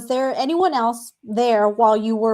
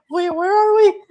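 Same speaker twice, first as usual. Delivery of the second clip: confused; 8 semitones higher, 5 dB louder than usual. Retold or imitated. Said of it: "I was like".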